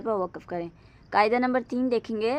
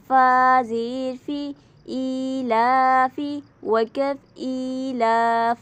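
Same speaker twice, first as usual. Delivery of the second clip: slow, louder spelling out of letters; spelling drill; 4 dB louder, much slower than usual. Shown as a demonstration. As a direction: spell each sound slowly for a child. Drills Qaida spelling aloud. (बच्चों को धीरे हिज्जे कराते हुए)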